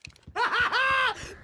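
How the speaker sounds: triumphant laugh. Acted. Barry laughing after the fireworks blast the enemies, triumphant. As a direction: burst out laughing in victory.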